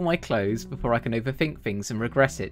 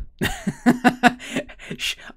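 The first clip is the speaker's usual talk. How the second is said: breathy laugh; 11 semitones higher, 5 dB louder than usual. Real, not acted. Laughing at the story.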